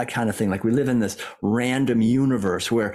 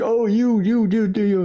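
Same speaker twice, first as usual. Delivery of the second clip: higher and louder, sputtering mock outrage; mock indignation; 8 semitones higher, 4 dB louder than usual. Someone slams into us and we bristle, mock indignant. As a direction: act out a huffy, offended comeback. Retold reaction to being wronged.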